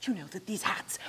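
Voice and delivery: unvoiced confiding whisper; secret whisper. Conspiratorial whisper.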